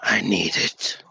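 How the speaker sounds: low, drawn-out statement of need; grave in-character plea. Acted. Character grabs the staff, pleading.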